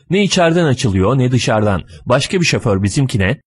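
plain reading voice

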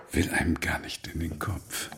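hushed, breathy whisper; whispered aside. Acted. Old man whispering his doubt to Martin. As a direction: drop to a secretive whisper. Confiding a dangerous thought.

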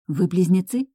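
usual speaking voice

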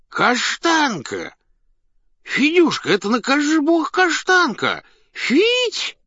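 put-on very high, loud drunken hollering; tipsy man calling out. A narrator's reading. Drunk man calls out to dog. (пьяный высокий окрик)

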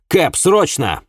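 pressing, high-pitched cry; urgent call. A narrator's reading. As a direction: call out for a cab at once.

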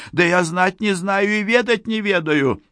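high-pitched, panicky protest; very high frightened peasant voice; squealed denial. A narrator's reading. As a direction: plead innocence in a squeal. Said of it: "взвизгнул пасечник".

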